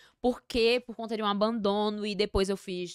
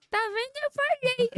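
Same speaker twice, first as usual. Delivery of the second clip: put-on very high little-kid "told you so"; gloating child; 3 dB louder than usual. Guest imitating her childhood self saying told you so to mother, gloating child. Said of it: "E eu bem como criança".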